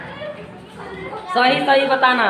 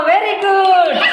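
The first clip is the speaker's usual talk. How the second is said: high, drawn-out delighted praise; excited praise; 7 semitones higher, 4 dB louder, much slower than usual. Real, not acted. Teacher cheering a pupil, drawn-out excited praise. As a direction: stretch it out with glee.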